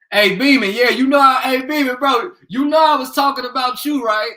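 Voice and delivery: loud, high hail to a friend; hey there, loud and excited; calling out. Real, not acted. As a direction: shout across to a buddy. Calling out to a viewer.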